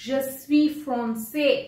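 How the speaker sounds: slow, careful teacher model; slow French model phrase. Shown as a demonstration. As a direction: spell it out slowly for the class. (धीरे-धीरे उच्चारण)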